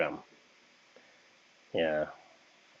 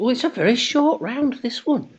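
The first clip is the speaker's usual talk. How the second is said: high amused; 13 semitones higher than usual. Real, not acted. Host jokes about short round.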